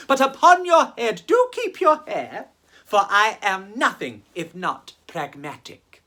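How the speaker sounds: usual speaking voice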